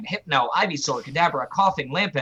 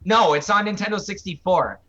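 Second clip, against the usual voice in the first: usual speaking voice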